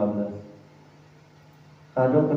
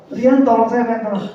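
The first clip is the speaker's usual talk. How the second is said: high, louder pleading call to Rian; mock cry for help; 7 semitones higher, 3 dB louder than usual. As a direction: act out a drowning man calling his friend. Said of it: "Di saat saya kelelep".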